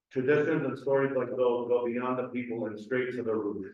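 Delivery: normal talking voice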